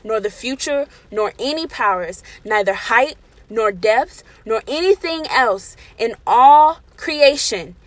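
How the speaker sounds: preaching. Acted. Preaching scripture at full force.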